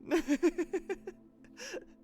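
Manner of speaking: a sudden high laugh bursts out; high burst of laughter. Real, not acted. Streamer laughing at her own guess about a viewer, a high laughing burst. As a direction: laugh out loud, genuinely amused.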